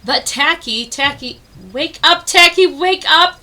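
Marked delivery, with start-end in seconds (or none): 1.7-3.4 higher, louder urgent call; crying out to wake him. Acted. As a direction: rouse a sleeping friend in a panic. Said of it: "they cried".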